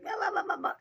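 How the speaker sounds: high-pitched mocking blah-blah; mock angry babble. Talking crap back at people online, mock angry babble. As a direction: imitate yourself ranting in a squeaky babble. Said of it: "Some people make me mad".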